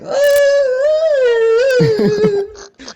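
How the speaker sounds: long high-pitched wail; hammed-up shriek of horror; mock scream. Seeing his feet turned to tentacles, mock horrified scream.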